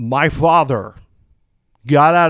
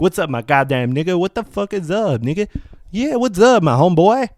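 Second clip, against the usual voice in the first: exaggerated put-on street accent, swooping high; mock put-on slang accent; 5 semitones higher than usual. Host mocks people faking the accent, exaggerated street accent. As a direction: mimic a forced street accent, pitch jumping up. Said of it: "they have to do the damn accent".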